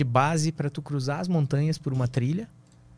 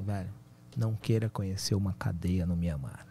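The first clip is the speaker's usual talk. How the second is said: death voice; 5 semitones lower, 4 dB quieter than usual. Retold or imitated. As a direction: drag it out like a slowed record. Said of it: "o cara tinha uma voz de morte, disco em baixa rotação".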